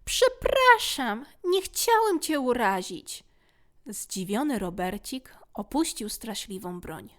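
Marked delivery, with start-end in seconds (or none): 0.1-3.2 muffled voice. Acted. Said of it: "kontynuował przytłumionym głosem".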